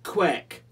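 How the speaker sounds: "Quack." said as "kweck", high and loud; loud duck call with "eh" as the vowel of "Quack."; "Quack." called out to rhyme with deck; quack with borrowed E. Acted. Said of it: "took the air from the hmm".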